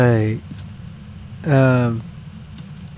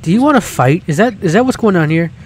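loud, higher-pitched, playful challenge; mock confrontation; 5 semitones higher, 6 dB louder, faster than usual. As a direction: pretend to pick a fight. Playful challenge after being ewed.

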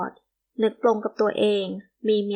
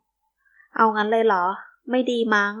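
plain reading voice

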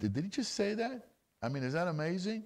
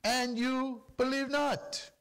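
Yeshua answering; 10 semitones higher, slower than usual. Acted. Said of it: "And Yeshua tells them".

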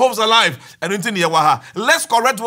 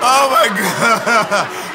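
slow words dissolving into laughter; laughing disbelief; much slower than usual. Real, not acted. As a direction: gasp it out through laughter. Still laughing at the joke.

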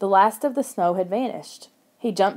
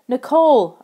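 loud, higher cry of a name; 5 semitones higher, 8 dB louder than usual. A narrator's reading. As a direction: call out to a sister in relief. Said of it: "I cried".

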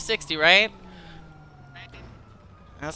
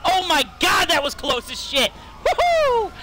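thrilled whoop; 7 semitones higher, slower than usual. Real, not acted. Narrow escape.

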